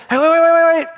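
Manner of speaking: high, loud pleading shout; urgent call. Retold or imitated. Father calling out to his daughter before she hangs up, loud urgent plea. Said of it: "I'd say".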